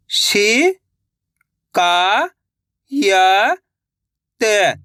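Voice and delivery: "शि-का-य-त" each syllable held apart, very slow; sounding out slowly. Shown as a demonstration. Teacher showing children how to sound out a word. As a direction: sound out "शि-का-य-त" one syllable at a time. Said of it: "एक एक अक्षर का उच्चारण करके".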